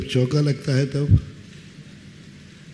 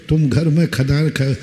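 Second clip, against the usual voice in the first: ordinary talk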